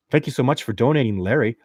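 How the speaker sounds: usual speaking voice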